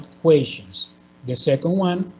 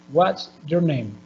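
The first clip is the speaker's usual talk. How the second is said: slow "What's your name?" dropping on "name"; measured WH-question, "name" falling at the end; falling intonation; slower than usual. Shown as a demonstration. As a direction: let "name" glide down to a close. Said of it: "It's falling".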